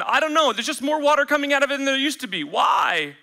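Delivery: high, fast, exasperated rush; flustered homeowner. Retold or imitated. Homeowner answering partner about the worsening leak, flustered and fast. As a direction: blurt it out panicking over the leak.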